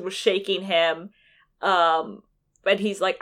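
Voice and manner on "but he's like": normal talking voice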